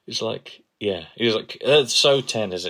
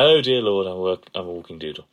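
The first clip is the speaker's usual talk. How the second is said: posh impression; 6 dB louder than usual. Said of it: "he just goes really posh, upper class".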